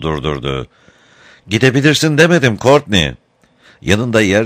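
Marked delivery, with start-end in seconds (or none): 1.5-3.1 higher-pitched, lightly voiced princess's rebuke; mild imperious reproach. A narrator's reading. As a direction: stop him with a touch of command. Princess stops Courtney from leaving. (hafif buyurgan, tiz bir azar)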